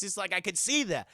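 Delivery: ordinary talk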